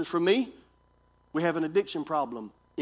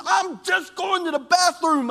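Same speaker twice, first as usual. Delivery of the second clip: much higher, slow voice of the wife; wife explaining; 11 semitones higher, 5 dB louder, slower than usual. Retold or imitated. Pastor voices his wife saying she's only going to the bathroom, high and slow. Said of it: "She says".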